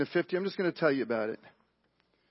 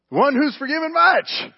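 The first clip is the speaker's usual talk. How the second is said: high, loud, overeager pupil; eager shouted answer; 11 semitones higher, 12 dB louder than usual. Acted. Smart Pharisee answering Jesus' riddle, eager shout. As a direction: blurt it out proudly, high and loud.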